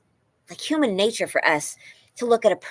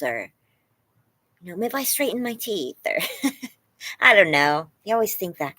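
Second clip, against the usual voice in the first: ordinary delivery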